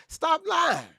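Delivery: high shout of stop lying. Real, not acted. Streamer shouting down a lying chatter. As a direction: shout it up at the top.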